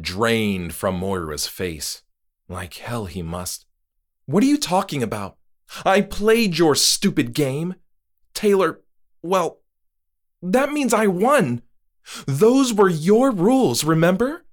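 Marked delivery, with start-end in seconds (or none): 4.3-14.5 much higher and louder, indignant; furious Moira. Acted. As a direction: fight for your win. Moira angry outburst.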